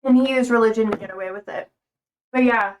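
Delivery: ordinary talk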